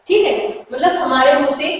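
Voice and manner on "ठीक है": ordinary talk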